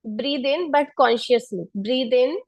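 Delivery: calm, unhurried meditation voice; guided meditation. Acted. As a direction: guide them gently through each breath. Leads seated pranayama. (ध्यान कराने वाली शांत आवाज़)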